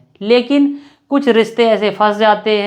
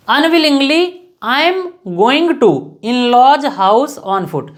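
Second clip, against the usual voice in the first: very slow, word by word, spacing each phrase; slow dictation; much slower than usual. Shown as a demonstration. Teacher dictating an example sentence to students, very slow. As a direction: spell it out slowly for students to copy. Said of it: "dictating the example slowly".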